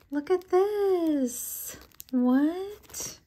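high, slow, thrilled look at this; light up at the surprise gift; excited gasp. Real, not acted. Unboxing her friend's package, thrilled and amazed.

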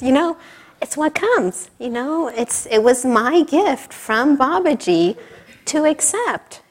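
usual speaking voice

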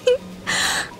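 short, high titter; teasing, airy snicker; sly giggle. Acted. Relishing what he will do.

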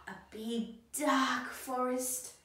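plain reading voice

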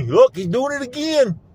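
high, loud jeering onlooker; mocking onlooker. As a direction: sneer like the passersby. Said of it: "Drive up and see me".

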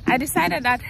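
usual speaking voice

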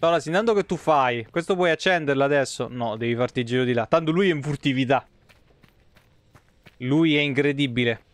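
ordinary talk, nothing marked

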